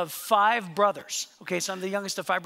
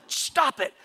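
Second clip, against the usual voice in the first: imitated exasperated mom, raised pitch; mock scolding, higher than usual; upset mom; 5 semitones higher than usual. Mom snaps at her sons over grace, mock upset. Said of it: "she gets really upset".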